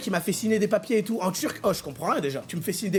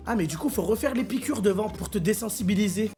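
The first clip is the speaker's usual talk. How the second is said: ordinary talk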